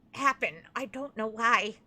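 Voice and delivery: ordinary talk, nothing marked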